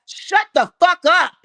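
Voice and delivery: loud, high furious command; angry shout. Real, not acted. Telling the crying person off, furious shout. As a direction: yell it to silence them. Ends taunt with angry order.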